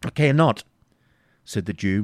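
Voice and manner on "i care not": high, loud desperate refusal; desperate defiance. Acted. Isaac defying the baron, desperate. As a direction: fling it back desperately. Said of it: "rendered desperate".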